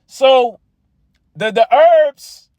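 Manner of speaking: rising fervor. Real, not acted. Preacher contrasting herbs with spirit.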